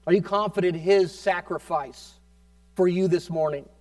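ordinary talk

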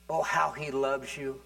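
ordinary talk

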